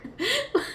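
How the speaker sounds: high-pitched burst of laughter. Real, not acted. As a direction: laugh high and bright at the memory. Laugh after the story.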